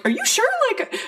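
high-pitched retold questions, rising at the end; imitated concerned checking. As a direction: check in on someone, voice lifted high. Man checking on her payment offer.

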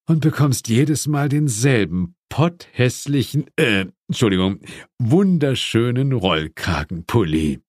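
lightly voiced demon, conspiratorial; sly demon confiding a joke; Asmodis, sly aside. A narrator's reading. Asmodis whispers to John about the sweater, sly.

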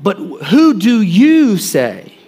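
slow, stress on "you" in "But who do you say?"; slow pointed question. Acted. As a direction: lean hard on "you", drawn out. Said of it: "he looks at them and he says".

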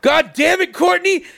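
high-pitched mock yell; exasperated high call for someone; retold exasperated yell. Hearing husband discover forgotten passport paperwork, retold exasperated yell. Mike heard yelling for Courtney.

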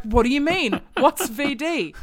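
exaggerated innocent asking; mock puzzled boyfriend. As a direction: ham up a clueless boy in a teen novel. Voices boy in book dialogue.

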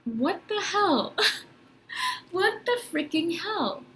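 high, slow mock disbelief; drawn-out high-pitched incredulity with a laugh; acted bewilderment. Acted bewildered thought to self.

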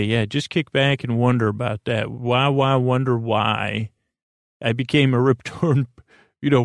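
ordinary talk, nothing marked

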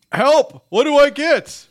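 high, loud, frantic plea; panicked cry for help. Acted. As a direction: cry out like a lost shopper. Imagined shopper panicking in mirror.

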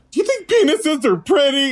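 high squeaky mock questioner; comic high-pitched quiz question; mock test question. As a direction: ask it absurdly high, as a joke. Mock test question, absurd twist.

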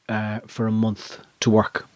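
usual speaking voice

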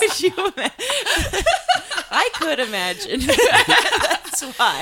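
talking through loud laughter; laughing. Real, not acted. Laughing at drowning guess.

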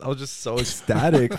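ordinary talk

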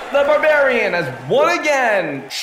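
booming ring announcer. Acted. Announcer declares the winner.

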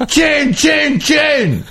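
high, rising hammed-up yell; mock-furious husband shouting over her chin; mock furious rant. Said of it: "He was like".

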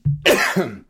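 one last forceful cough to clear the throat; loud real cough. Real, not acted. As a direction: cough it out hard. Guest choking on water.

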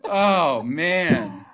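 ordinary talk, nothing marked

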